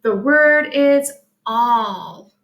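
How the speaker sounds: "all" said as awl, slow and falling; teacher models "all" slowly; correct pronunciation. Shown as a demonstration. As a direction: stretch "all" clearly. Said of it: "not how we pronounce it".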